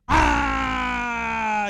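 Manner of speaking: sudden loud warrior yell; a war shout to gain strength; battle cry shout. Shown as a demonstration. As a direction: let out a full-chested battle cry. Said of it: "el voz de júbilo era un ¿qué? Era un tono".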